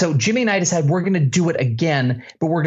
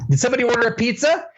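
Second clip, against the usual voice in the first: mock pizza delivery guy; 6 semitones higher, much faster than usual. Repeating the pizza guy prank.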